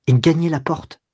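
ordinary delivery, nothing marked

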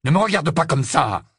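sharp, heated order; curt anger. A narrator's reading. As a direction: snap at her angrily. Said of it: "lança-t-il sèchement".

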